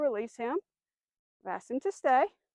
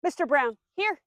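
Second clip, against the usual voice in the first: calling the dog; 4 semitones higher, 5 dB louder than usual. Shown as a demonstration. Trainer calling her dog to come, louder. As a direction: call the dog over brightly, a bit louder.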